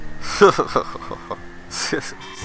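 breathy laughter; helpless post-performance chuckle; real laugh. Real, not acted. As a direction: laugh at your own performance. Laughs after showing his dance.

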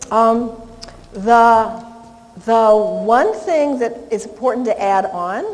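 normal talking voice

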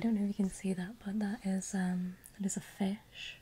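hushed roleplay voice. Pretend. Showing the viewer a fish, hushed near-whisper. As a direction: keep it very quiet and breath-led.

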